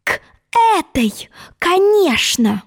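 high, indignant, each word separated. Acted. Alice pointing the frog to the door, word-by-word indignation. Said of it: "раздельно произнесла она".

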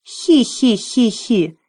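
slow high jeering hee-hee; mocking dragon giggle. A narrator's reading. Dragoness mocking Jim with a slow giggle. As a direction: cackle slowly to mock him. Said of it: "разразилась издевательским смехом".

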